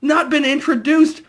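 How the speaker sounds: high pitched what-is-happening yelp; incredulous high cry. Retold or imitated. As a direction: cry out in baffled outrage. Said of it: "And I was like".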